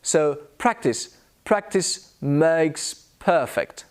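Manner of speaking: ordinary delivery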